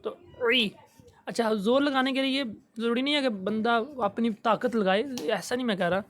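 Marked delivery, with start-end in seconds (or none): 0.0-0.7 عی squeezed from the mid throat. Shown as a demonstration. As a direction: press عی from the middle throat. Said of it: "زور لگائیں گے حلق کا درمیانی".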